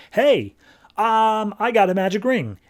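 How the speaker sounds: mock sly salesman. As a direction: sell the ring like a shady salesman, lightly. Alien offers ring slyly.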